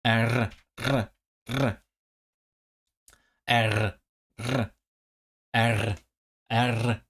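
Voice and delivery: short repeated tries at a rolled "R"; vowel plus R. Shown as a demonstration. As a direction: trill each "R", some after a vowel. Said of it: "vowel plus R".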